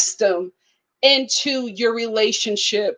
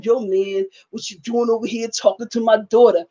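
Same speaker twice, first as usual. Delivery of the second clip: lightly voiced tough dad; 2 semitones lower than usual. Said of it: "[name] gonna play into that role".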